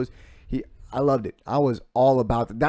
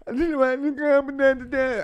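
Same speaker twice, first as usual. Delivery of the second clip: much higher, mock teary fighter voice; imitating crying fighter; 14 semitones higher, 4 dB louder than usual. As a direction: act out a fighter's tearful retirement words. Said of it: "like, cry".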